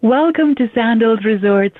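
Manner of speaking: normal talking voice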